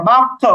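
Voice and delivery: "বাক্য" with rounded, o-coloured bounce; qalqalah tilted upward. Shown as a demonstration. Teacher shows the sound going upward, rounded heavy qalqalah. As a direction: round the mouth on the echo of "বাক্য". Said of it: "একটু জবরের দিকে উচ্চারণ করছি".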